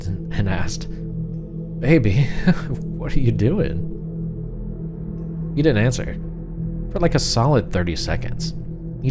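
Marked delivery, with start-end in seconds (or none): 1.8-3.9 nervous laugh. Retold or imitated. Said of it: "I kinda gave this nervous laugh".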